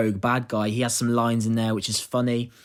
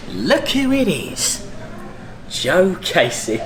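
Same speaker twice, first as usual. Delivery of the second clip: big, loud, drawn-out Cockney greeting; slow, high, loud Cockney swagger; Cockney London accent; 5 semitones higher, 5 dB louder, much slower than usual. Acted. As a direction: hail someone slowly as a confident Cockney rogue. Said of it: "I am portraying a Cockney London accent".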